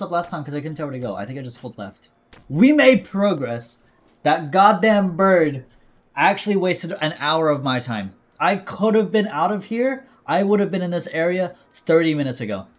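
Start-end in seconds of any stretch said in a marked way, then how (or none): 2.5-3.8 loud triumphant. Real, not acted. Frustrated gamer finally advances. 4.2-12.7 angry rant. Real, not acted. Ranting at game bird.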